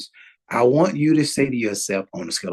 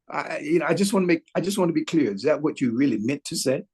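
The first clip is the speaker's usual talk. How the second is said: ordinary talk, nothing marked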